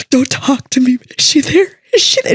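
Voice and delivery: fretting father choking up before seeing his daughter; panicky dad. Retold or imitated. Nervous dad asking for the bride, voice climbing. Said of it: "he's like".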